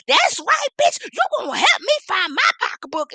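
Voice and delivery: high, loud mock triumphant yell; mocking loud demand. Mocking the woman running after the lady, yelling loudly. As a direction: ham up the woman's smug shout. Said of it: "you all running behind".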